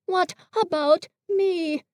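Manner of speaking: a small forlorn plea; sad. Acted. Pooh feeling left out, sadly and tearfully. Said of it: "said Pooh sadly".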